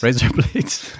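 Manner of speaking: a hint of a hammed-up Aussie twang on "Razor blades"; lightly mocked Australian accent. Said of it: "your accents are not good".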